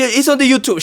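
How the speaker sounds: high, loud, irate mock French accent; mad French woman. Bandleader imitating the woman getting mad, mock French accent. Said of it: "She gets really mad".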